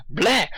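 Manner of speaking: fed-up blah; frustrated blah. Real, not acted. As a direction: spit out a blah at your own stumbling. Frustrated outburst.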